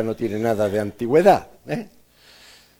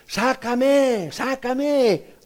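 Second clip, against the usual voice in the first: high, begging cry to be let out; clay in the oven, higher; pleading teacup; 13 semitones higher, much slower than usual. Acted. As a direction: plead from the heat, pitched up. Teacup burning in the kiln.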